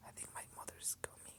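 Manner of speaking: breathy unvoiced whisper. A secret whispered to no one, in a whisper. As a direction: hush it, barely a breath.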